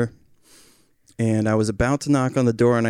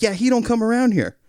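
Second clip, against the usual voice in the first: high-pitched imitation of a curt man; pitched-up, insistent brush-off; dismissive neighbor; 10 semitones higher than usual. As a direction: cut in as the agitated neighbor. Neighbor cutting him off.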